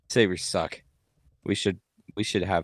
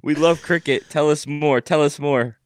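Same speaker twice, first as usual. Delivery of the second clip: higher and louder, fake eager cheer; hammed-up excited fan; mock enthusiasm; 7 semitones higher, 7 dB louder than usual. Teasing the cricket fun fact.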